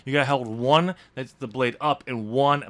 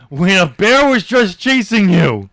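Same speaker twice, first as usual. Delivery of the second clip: incredulous; 6 semitones higher, 9 dB louder, slower than usual. Real, not acted. Mock incredulous question to co-host.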